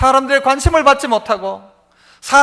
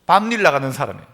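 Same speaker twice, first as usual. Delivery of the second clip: low and fast, matter-of-fact; quick answer; 8 semitones lower, faster than usual. Retold or imitated. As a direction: toss it off quickly. Quoting pastor's quick answer. (낮고 빠르게 툭)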